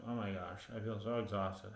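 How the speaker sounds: quietly drained, sighing it out; worn-out sigh. Retold or imitated. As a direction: sound like you have nothing left. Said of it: "it's like".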